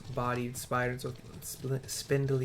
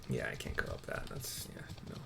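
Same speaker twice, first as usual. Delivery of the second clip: whispered muttering; 13 semitones lower, 9 dB quieter, faster than usual. Real, not acted. Player muttering to himself that he can't climb it, whispered. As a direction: whisper it under your breath.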